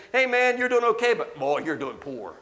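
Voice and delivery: high, loud jokey judging voice; ribbing a friend, half-mocking; mocking judgmental churchgoer.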